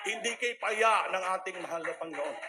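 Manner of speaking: fervent loud declaration; loud proclamation. Real, not acted. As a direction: proclaim it to the room. Testimony climax, repeated.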